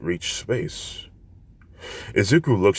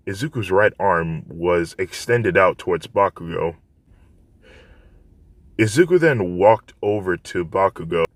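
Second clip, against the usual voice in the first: ordinary delivery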